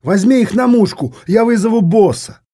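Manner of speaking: high, loud panicked yell; nervous shout. Acted. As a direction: cry out in alarm. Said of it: "нервно выкрикнул Алденин".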